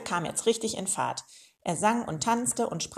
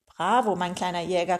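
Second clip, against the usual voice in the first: usual speaking voice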